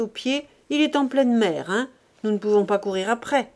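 loud, scornful scolding; angry miser. Acted. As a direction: browbeat the wife. Grandet berates his wife.

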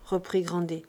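ordinary delivery

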